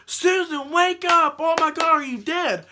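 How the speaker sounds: high, loud re-enacted yell; retold panicked yell. Friend yelling at unconscious Susan after the wreck, retold panicked yell. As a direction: imitate yelling at a friend to wake her. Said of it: "yelled at her".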